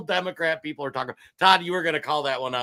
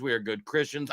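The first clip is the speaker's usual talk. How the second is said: ordinary talk, nothing marked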